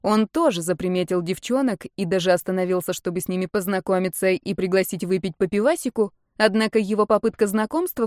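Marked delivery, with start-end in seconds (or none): none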